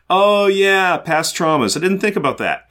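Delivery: mock afterthought, voice lifted; mock realization. Podcaster voicing a scientist who forgot past traumas, mock realization. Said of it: "he's all like".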